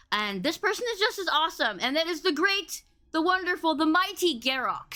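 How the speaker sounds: big build-up for the guest; hype intro. Real, not acted. Hyping up the guest's entrance.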